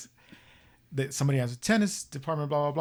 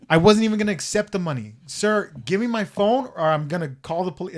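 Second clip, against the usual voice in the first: loud, raised-pitch retold argument; exasperated demand; 5 semitones higher, 7 dB louder than usual. Woman demanding her phone back from old man, exasperated. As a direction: argue it loudly, fed up.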